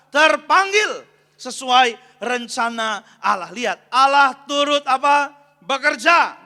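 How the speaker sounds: very loud call-and-response verse reading; khotbah lantang. Real, not acted. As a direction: belt out the verse, prompting the congregation. Memimpin jemaat membaca ayat. (membaca ayat dengan lantang berapi-api)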